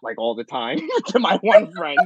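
ordinary talk, nothing marked

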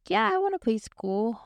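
put-on high, bright little-girl yes; excited small child agreeing; eager little kid. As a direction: jump at the offer like a three-year-old. Little me answering cousin.

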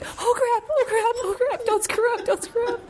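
high, squeaky mock panic; frantic self-talk on a high pitch; panicked inner voice. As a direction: act out a head spinning with nerves. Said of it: "my head is going like".

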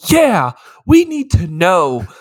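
slow, loud dim-witted agreement; dopey slow chorus. Retold or imitated. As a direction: say it like two airheads in unison.

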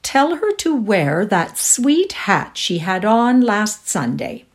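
slow, loud, lilting call; floating down the stairs. A narrator's reading. Mother upstairs instructing her daughter, calling down. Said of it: "voice floated down the stairs".

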